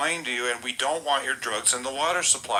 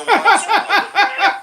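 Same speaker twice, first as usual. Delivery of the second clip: high, loud, slow echo; 12 dB louder, much slower than usual. Acted. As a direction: boom it out like an echo. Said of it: "your voice just echoed".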